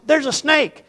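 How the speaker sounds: high, frightened yelp of a panicked mother; retold scream. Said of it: "Mama screams".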